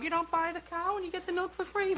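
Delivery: high, sing-song mother dropping a hint; mother's pointed hint, voiced high up; mom hint voice. Mother hinting over dinner, in high sing-song voice. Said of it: "those kind of mom hints".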